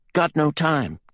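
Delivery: high, firm, pressed reply; urgent refusal. A narrator's reading. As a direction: refuse him in a hurry. Candy refuses during the struggle.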